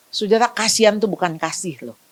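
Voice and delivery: normal talking voice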